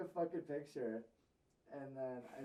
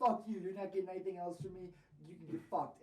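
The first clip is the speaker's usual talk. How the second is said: loud, high retold outburst; going off angrily; 5 semitones higher, 5 dB louder than usual. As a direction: act out telling someone off, starting at full force. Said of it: "you started going off on him".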